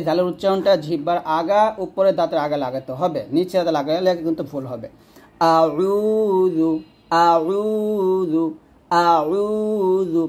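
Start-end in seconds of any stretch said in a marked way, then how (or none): 5.6-10.2 আউযু slowly drawn, light thin ز sound; thin light zal. Shown as a demonstration. Teacher drilling আউযু with the thin zal. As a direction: stretch আউযু with a light tongue tip. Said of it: "পাতলা".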